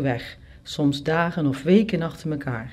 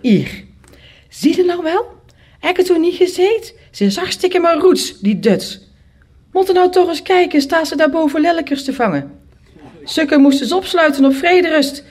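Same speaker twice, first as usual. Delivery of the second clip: high, loud scandalised gossip; indignant neighbour woman; 10 semitones higher, 5 dB louder than usual. A narrator's reading. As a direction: crow about the madwoman to the maid. (verontwaardigde buurvrouw, hoog en luid)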